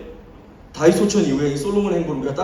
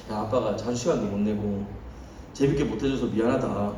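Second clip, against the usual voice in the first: softer, lower apology to his son; sincere apology; 7 semitones lower, 8 dB quieter than usual. Retold or imitated. Father gently apologizing to his young son, softly. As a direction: say sorry gently, a bit embarrassed.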